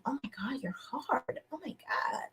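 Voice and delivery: breathy flirty whisper. Retold or imitated. Reviewer mimics a woman flattering a man in a whisper. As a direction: whisper it like a secret come-on.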